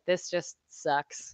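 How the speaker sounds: slow, soft, dejected. Retold or imitated. Racer walking through pits after crash, dejected. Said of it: "one of those moments where I was like".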